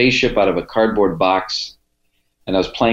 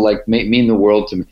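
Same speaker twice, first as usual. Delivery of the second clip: normal talking voice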